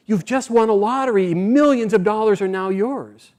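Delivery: high, loud messenger's proclamation; excited announcement. Acted. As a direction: burst out with thrilling news. Messenger rushes up with good news.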